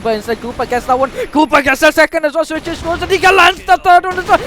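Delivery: building to a loud, high shout; excited play-by-play. Real, not acted. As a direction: call the fight rising to a yell. Hyped live play-by-play calling.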